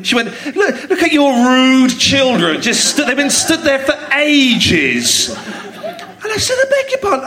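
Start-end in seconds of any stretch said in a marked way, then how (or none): 0.6-5.3 high, slow, drawn-out complaint; mock indignant woman. Woman complaining about a father's rude children, mock indignant. 6.6-7.3 very high-pitched disbelief; mock affronted. Father answering the rude woman, affronted.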